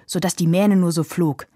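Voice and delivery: ordinary delivery, nothing marked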